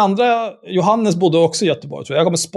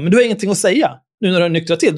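ordinary delivery

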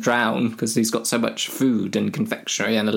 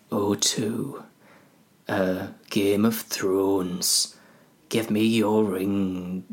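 advert voice-over; much slower than usual. Shown as a demonstration. Having a go at an advert voice, slow voice-over. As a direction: draw it out like a phone company advert. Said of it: "an O two advert voice. Let me, let me have a go".

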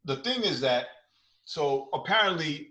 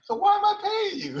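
laughing; 7 dB louder than usual. Acted. Mock complaint while laughing.

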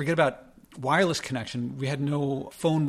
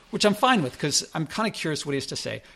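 ordinary talk

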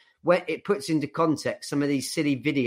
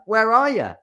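much higher, louder mock demand; mock shrill wife; 11 semitones higher, 7 dB louder than usual. Wife on the phone to husband, mock high demand. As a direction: play the wife yelling down the phone. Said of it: "she rings him and she's like".

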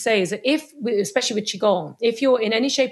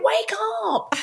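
high-pitched mock call to wake them; mock shout; 12 semitones higher than usual. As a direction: call out high as if through a bubble.

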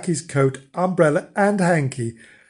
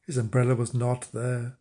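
plain reading voice

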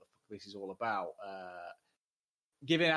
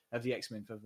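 usual speaking voice

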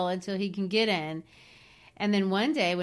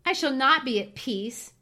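louder, higher lament; anguished, raised-pitch protest; moaned; 5 semitones higher, 5 dB louder than usual. Acted. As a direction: moan it out in distress. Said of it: "moaned Cathy".

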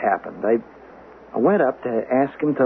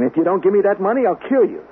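raised, higher threatening shout; a retold angry yell, fairly loud; 6 semitones higher, 3 dB louder than usual. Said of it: "I shouted at him".

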